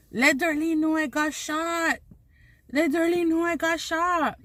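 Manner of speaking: a strained, pleading wounded man; shot man's plea. Retold or imitated. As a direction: beg like you're lying shot on the ground. Dying neighbour voiced on floor.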